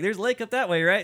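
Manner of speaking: high, loud, blithe imitation; carefree hiker. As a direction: chirp it loudly like a clueless happy hiker. Acting out carefree hikers with beers.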